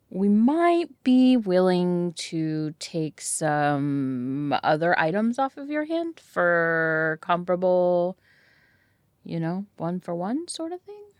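drawn-out, very slow, careful offer; coaxing haggle. Acted. Customer haggling with merchant over incense, slow and coaxing. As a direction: stretch the words out while floating a tentative trade.

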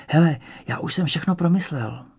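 ordinary delivery

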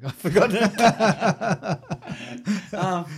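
laughing through the words, slow and loud. Real, not acted. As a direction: burst out laughing at yourself. Laughing at forgetting his joke.

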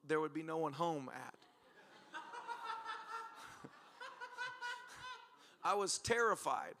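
ordinary talk, nothing marked

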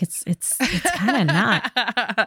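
slow, sheepish trailing reply; mock awkward office guy; awkward hedge. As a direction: drag it out, embarrassed. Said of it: "He's like".

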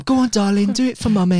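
high, sugary stage-mum coaxing; pushy mum voice. Retold or imitated. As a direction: coax like a pushy showbiz mother. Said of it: "she had this mum".

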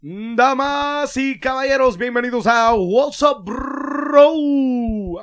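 big booming ring announcer, slow and high; lucha libre announcer. Pretend. [name] told to go, sound check.

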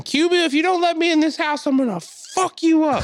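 high-pitched, loud screamed threat; imitated screaming. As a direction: imitate an enraged woman screaming at a man indoors. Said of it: "screaming at the guy inside".

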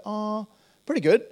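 mimicking her casual reply; breezy reply. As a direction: toss it off lightly.

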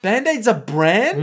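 can't believe what she just heard; stunned double-check; incredulous. Real, not acted. Disbelief at Band-Aid being brand.

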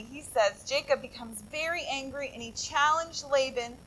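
ordinary talk

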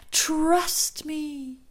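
high, loud, drawn-out first call of an echo; ringing voice. Retold or imitated. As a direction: let it ring out high and strong. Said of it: "her friend's voice ringing in her ears".

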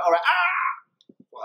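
high, long "ah"; mock yell on being hit; re-enacted drawn-out yelp; retold cry of pain.

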